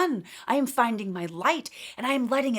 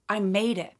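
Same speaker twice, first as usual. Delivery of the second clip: usual speaking voice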